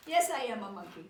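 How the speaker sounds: loud, emphatic monkey proclamation; hammed-up declaration. Book makes reader claim robot monkey, loud mock declaration. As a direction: declare it forcefully, like a forced confession.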